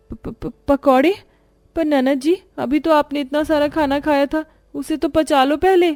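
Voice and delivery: stammering shock. Acted. Bhabhi stammering in disbelief.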